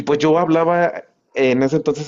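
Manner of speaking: ordinary talk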